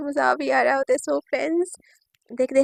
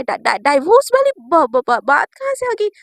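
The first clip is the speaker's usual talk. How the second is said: loud, stuttering alarm on each first syllable; shocked stammering; 8 dB louder than usual. Acted. Naina hearing her wife demand divorce, shocked stammering. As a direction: trip over the words in mock shock. तलाक़ सुनकर हकलाती नैना.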